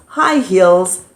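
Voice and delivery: plain reading voice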